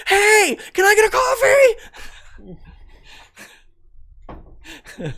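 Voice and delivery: very high, full-volume comic yell; hammed-up shouting. Customer ordering after masks come off, exaggerated shouting. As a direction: bellow it as if still masked. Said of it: "everybody's still gonna be shouting".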